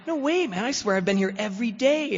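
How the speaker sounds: high-pitched mock-indignant denial; shocked protest. Loaded student swearing to the teacher, shocked and protesting. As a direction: protest innocence in a squeaky put-on voice. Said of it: "I'm shocked. I'm like".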